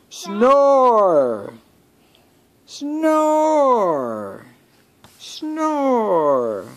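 very high, drawn-out storybook snore word; Piggy. A narrator's reading. Piggy snores loudly in his sleep, high drawn-out cry. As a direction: stretch each word high, then let it fall. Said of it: "he's a loud snorer".

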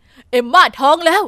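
loud, thrilled announcement; an overjoyed dad telling his child; excited news. Acted. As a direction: burst out with the happy news. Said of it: "พ่อตื่นเต้นวิ่งมา".

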